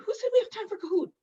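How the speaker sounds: teasing fake outrage at the students; mock indignation. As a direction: fire it back in pretend shock. Teacher pretends to refuse game time.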